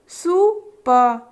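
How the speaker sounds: very slow syllable drill; teacher building words slowly; slow sounding out. Shown as a demonstration. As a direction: stretch each part for children. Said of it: "उच्चारित करने के लिए कहेंगे".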